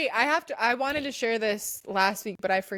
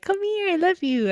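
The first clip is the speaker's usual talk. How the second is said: put-on baby talk; 11 semitones higher, 4 dB louder than usual. Cooing at babies.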